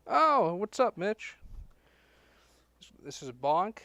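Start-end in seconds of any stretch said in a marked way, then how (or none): 0.1-1.3 loud, high, delighted hello; excited greeting. Real, not acted. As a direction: greet him with a big burst of joy. Greeting friend joining.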